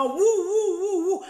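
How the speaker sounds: high, loud woof woof; mock dog barking; barking imitation. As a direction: bark like a dog. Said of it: "Moi, j'aboie".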